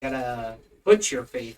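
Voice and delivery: ordinary talk, nothing marked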